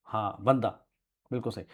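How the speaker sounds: ordinary talk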